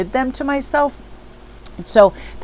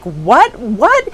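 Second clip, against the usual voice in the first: startled mom; 4 semitones higher, 6 dB louder than usual. Retold or imitated. Mother startled by her kid.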